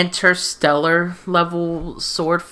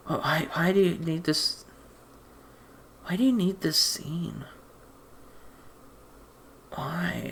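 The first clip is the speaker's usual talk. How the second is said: whispered, baffled why; exasperated whisper; 7 dB quieter than usual. Real, not acted. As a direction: breathe the question out, fed up. Exasperated aside about pointless scene.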